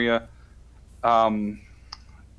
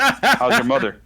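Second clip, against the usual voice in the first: high, loud, slow Boston greeting; Boston accent; 13 semitones higher, 8 dB louder, slower than usual. Retold or imitated. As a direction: belt it out like a Boston guy. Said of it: "greeting people like".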